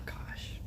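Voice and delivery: long unvoiced sigh, then gosh breathed out; weary sigh. Real, not acted. Fed up with the court case, a whispered sigh and gosh. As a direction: let the air out, defeated, and whisper gosh. Exasperated over the foster baby.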